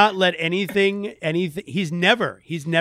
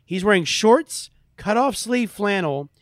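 normal talking voice

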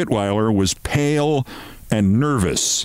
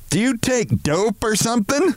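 much higher woman's voice, coldly suspicious; cold question; 13 semitones higher than usual. A narrator's reading. Woman questioning colleague's wild talk, cold. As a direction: ask it coolly, pitched way up. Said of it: "Missus Barrows asked coldly".

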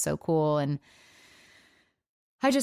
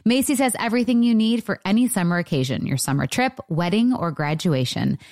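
louder, upbeat sponsor read; 5 dB louder than usual. Acted. Host reading a Macy's sponsor spot to listeners, upbeat ad read. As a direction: sell it like a bright radio spot.

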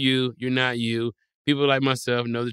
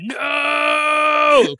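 very high, loud cry of horror; hammed-up shriek of horror; horrified shout; 8 dB louder than usual. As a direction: scream it as you see the wrong face. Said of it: "you like".

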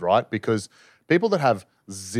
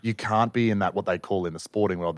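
ordinary talk

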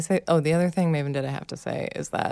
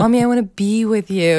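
louder, higher mock child plea; hammed-up clingy child, louder and higher; imitated pleading kids; 5 semitones higher, 6 dB louder than usual. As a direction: plead like a kid at bedtime. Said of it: "That's what they always say".

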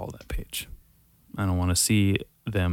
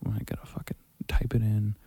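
whispered aside to himself while typing; under-the-breath grumble, much softer; whispered mutter; 8 dB quieter than usual. Real, not acted. Muttering to himself as he types the search, in a whisper.

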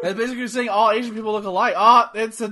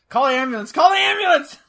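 fake heart attack; 5 semitones higher, 4 dB louder than usual. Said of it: "the old man getting robbed".

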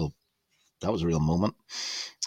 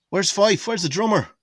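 calling out; 12 semitones higher, 4 dB louder than usual. Retold or imitated. [name] calling out from stage.

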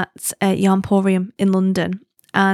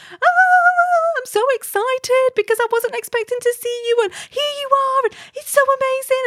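very high squealing over-excited yelp, stretched out; high-pitched puppyish squeal of delight; demented spaniel puppy. Retold or imitated. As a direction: squeal like a yappy puppy thrilled to see a friend. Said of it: "demented spaniel puppy".

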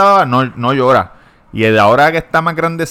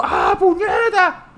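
much higher mock scream, half-laughing; retold scream; 4 dB quieter, much slower than usual. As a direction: yelp it out like a kid waking up burned, laughing as you do. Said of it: "se levante gritando".

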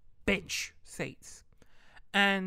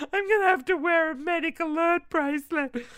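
much higher, louder hammed-up lament; dramatic mock wail; 11 semitones higher, 9 dB louder than usual. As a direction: wail it like a tragedy. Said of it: "so dramatic".

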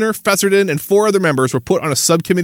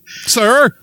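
sarcastic polite address; 7 semitones higher than usual. Acted.

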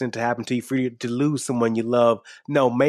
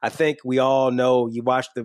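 ordinary delivery

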